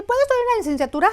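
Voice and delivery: put-on high, fast, pleading teen voice; eager teen asking. Retelling herself as a girl asking her mother, high and rushed. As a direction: rush the question up high like an excited kid.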